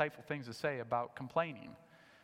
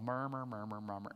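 murmuring sound; 7 semitones lower, 5 dB quieter than usual. Shown as a demonstration. Preacher showing how murmur sounds, soft low murmur. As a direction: lower and softer, murmured under the breath. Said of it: "The actual word sounds like the meaning".

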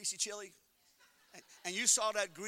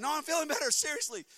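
argument; 8 semitones higher, 7 dB louder than usual. Retold or imitated. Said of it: "You have this argument".

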